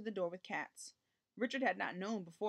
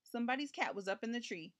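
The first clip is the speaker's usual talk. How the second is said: plain reading voice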